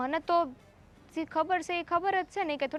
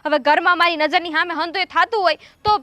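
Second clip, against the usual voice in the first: raised, indignant retort to the madam; loud talking back; 9 dB louder than usual. Acted. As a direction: push back at her, louder. Maid talks back to madam. (મોટેથી સામો જવાબ)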